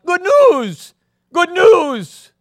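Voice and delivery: high, loud joyful yell; excited shout. Acted. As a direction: shout it across the camp like an eager boy. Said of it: "he was shouting at the top of his lungs".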